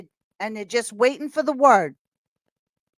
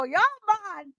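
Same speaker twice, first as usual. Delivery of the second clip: mock Jamaican greeting; 7 semitones higher, slower than usual. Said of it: "I heard Miss Rastafari and go".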